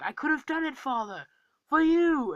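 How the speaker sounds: high, anguished mock plea, swelling at the end; pleading Loki. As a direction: imitate Loki crying out to his father. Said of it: "Loki is like".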